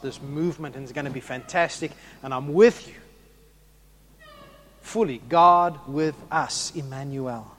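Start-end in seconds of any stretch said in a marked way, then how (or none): none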